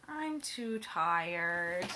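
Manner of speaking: very slow, low and sleepy; tired. Acted. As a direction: drag it out, nearly asleep. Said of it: "Then he said".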